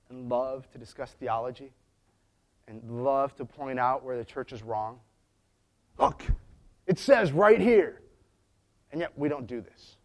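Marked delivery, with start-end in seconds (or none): 6.0-8.0 slow, high, loud know-it-all jab; mock know-it-all. Mocking a Bible-arguing know-it-all, slow loud jab.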